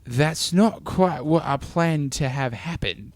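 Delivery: slow imitation of a Menite accent; mock Menite accent. Arrogant leader left lamenting his failed plan, in a mock Menite accent. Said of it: "is that what a Mennonite accent sounds like".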